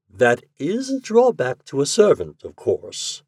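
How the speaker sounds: measured, deliberate reply; slowly. A narrator's reading. Secretary with eyes on his plate, speaking slowly. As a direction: choose the words slowly and carefully. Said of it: "Shorthouse spoke slowly".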